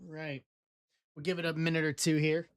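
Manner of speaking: ordinary delivery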